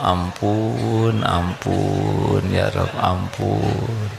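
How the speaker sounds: ordinary delivery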